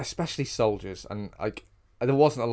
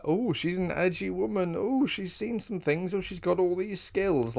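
higher, sing-song parody of a cliché; mocking trope voice; 8 semitones higher than usual. Podcast host mocks the edgy-woman trope, in a sing-song parody. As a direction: send up the tough-woman trope with a lilt. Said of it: "It's your typical, like".

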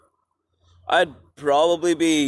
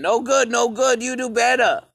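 higher-pitched imitation of a yelling boss; mock screaming supervisor; 7 semitones higher than usual. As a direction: parody the woman screaming over you.

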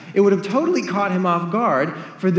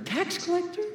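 shocked echo; 12 semitones higher, 9 dB quieter than usual. Retold or imitated. Crowd shocked at tax collector.

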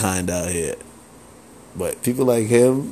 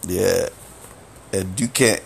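ordinary talk, nothing marked

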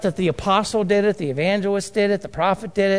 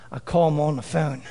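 ordinary delivery

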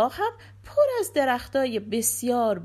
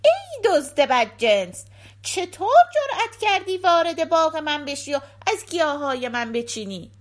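loud, higher, scolding sorcerer; wicked sorcerer; 5 semitones higher, 6 dB louder than usual. A narrator's reading. Sorcerer catching a thief in the garden, loud accusing villain. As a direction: confront the thief at full voice as the villain. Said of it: "جادوگر بدجنس سر رسید".

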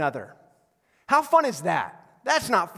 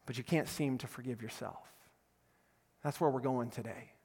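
tender pastoral; 4 semitones lower, 5 dB quieter than usual. Acted. Pastor consoling hurt listeners, soft and tender. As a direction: speak gently to the wounded.

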